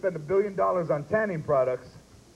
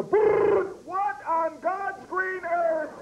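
shocked neighbor; 10 semitones higher, much slower than usual. Pretend.

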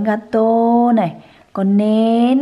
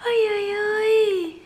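a much higher squeal of delight; 11 semitones higher, 5 dB quieter than usual. Real, not acted. Mother squealing with delight at her baby.

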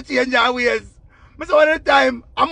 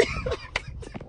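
soft, voiceless cracking up; quiet wheeze of laughter; helpless laugh; 14 dB quieter than usual. Real, not acted. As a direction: laugh yourself out of breath. Cracks up at own imitation.

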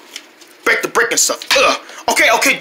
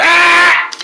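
loud high furious yell; a sudden angry scream; 9 semitones higher, 5 dB louder than usual. Acted. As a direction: let out a shout of rage.

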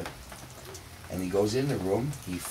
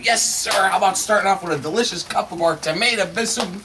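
high, sales-pitch lilt; eager waiter; 8 semitones higher, 5 dB louder than usual. Acted. Waiter offering soup to the guest, eager. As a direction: pitch the soup brightly and loudly.

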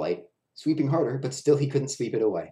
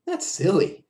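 high, brushing-off friend; friend's voice; 10 semitones higher, 4 dB louder, slower than usual. A narrator's reading. Clown friend dismisses Willie's fear, light and high. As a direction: wave the worry away lightly. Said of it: "said his friend".

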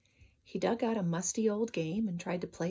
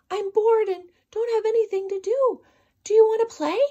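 much higher, much louder call; shout; 12 semitones higher, 9 dB louder than usual. Acted. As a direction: call up to the birds at full voice. Leo keeps shouting to birds.

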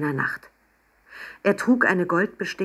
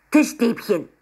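high, louder little creature voice; a small penguin demanding his treat; 6 semitones higher, 4 dB louder than usual. Acted. Penguin Papanini demands fish sticks from Emma, high and pushy. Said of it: "forderte er".

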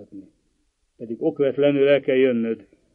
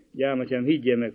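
ordinary delivery

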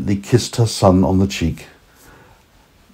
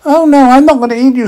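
put-on high, loud tiny boy voice; tiny boy meets a talking carrot, curious and high; 5 dB louder, faster than usual. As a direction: squeak it out like a small child. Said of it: "said the little boy".

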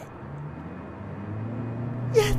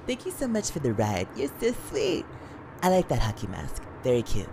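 flirty; 3 semitones higher, 4 dB quieter than usual. Acted. Flirting with the masked killer.

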